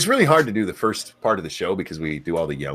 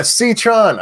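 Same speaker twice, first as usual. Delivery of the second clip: loud high name shout; screaming names; 12 semitones higher, 6 dB louder than usual. Real, not acted. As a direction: belt the viewer's name out. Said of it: "I have to stop screaming that".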